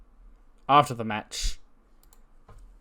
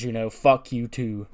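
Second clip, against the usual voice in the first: normal talking voice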